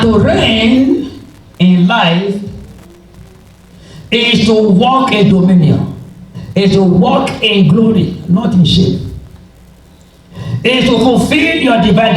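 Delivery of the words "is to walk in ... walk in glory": ordinary talk, nothing marked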